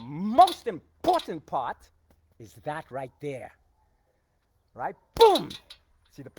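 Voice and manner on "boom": sharp loud high yell on impact; shouted boom. Real, not acted. Coach punching the bag, yelling boom. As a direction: bark the hit out.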